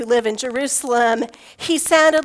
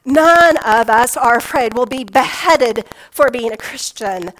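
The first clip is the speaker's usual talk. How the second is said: ordinary talk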